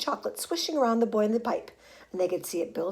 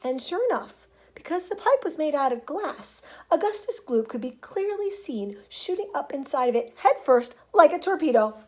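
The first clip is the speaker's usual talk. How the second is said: ordinary delivery, nothing marked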